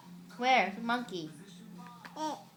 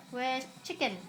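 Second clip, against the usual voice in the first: usual speaking voice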